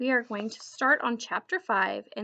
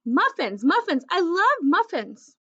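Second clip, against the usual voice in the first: higher, louder gleeful chant; excited puppy; 7 semitones higher, 5 dB louder than usual. A narrator's reading. Puppy circling the visitor with muffins, gleeful. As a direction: bounce on every muffin. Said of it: "running in circles".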